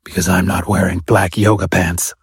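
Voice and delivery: performative gravel-voiced Batman growl, fading at the end; whisper growl. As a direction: snap it back in a put-on growl that peters out. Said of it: "snapped back in a performative whisper growl that petered out".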